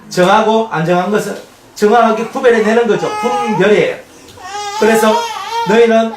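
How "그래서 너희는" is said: cracking up high with feeling; voice breaking. Real, not acted. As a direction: let the voice break as you preach. Emotional preaching on scripture.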